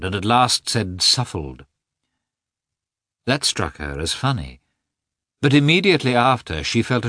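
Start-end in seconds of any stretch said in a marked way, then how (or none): 1.0-1.6 "suffled" blurted for suffered; mispronounced. A narrator's reading. Husband blundering as he says "suffled". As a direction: say "suffled" with an l in place of the r. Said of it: "could not pronounce the word".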